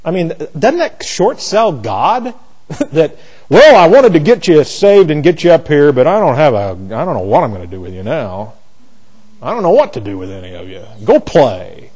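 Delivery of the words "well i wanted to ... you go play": God voice. Pretend. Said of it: "That's my God voice".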